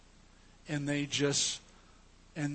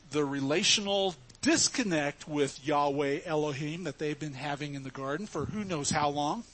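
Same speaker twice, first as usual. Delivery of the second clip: ordinary talk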